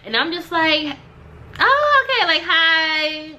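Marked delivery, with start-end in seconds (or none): 1.6-3.3 high, taken-aback oh okay; acting out an uneasy hello; awkward greeting. Walking in on a hostile room, high awkward hello.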